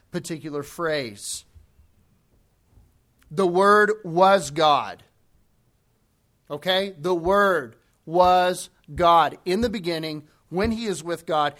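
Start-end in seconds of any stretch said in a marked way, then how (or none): 3.3-5.0 slow, loud, every word weighted; emphatic. Real, not acted. Preacher stressing key phrase. 7.0-9.4 slow, loud, word by word; emphatic. Real, not acted. Preacher repeating key phrase.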